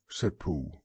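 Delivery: usual speaking voice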